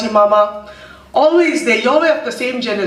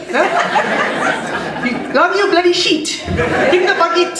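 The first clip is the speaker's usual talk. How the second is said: higher, laughing imitation of a Zulu-accented English scold; laughing Zulu-accented scolding, much higher; imitating mum's Zulu accent; 9 semitones higher, much slower than usual. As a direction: fondly mimic mum barking orders in a Zulu accent. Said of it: "My mother spoke English with a Zulu accent".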